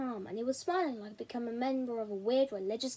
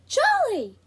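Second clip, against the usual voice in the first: high, loud call across the room; shout; 11 semitones higher, 9 dB louder than usual. Acted. Boy spots his lost best friend, shouting. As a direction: yell a name across the room. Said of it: "I shouted".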